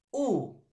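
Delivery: U held out and loud; stretched vowel demo. Shown as a demonstration. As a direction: sound out U clearly for the class. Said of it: "Es la I y la".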